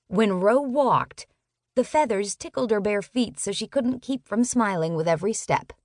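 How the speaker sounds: ordinary delivery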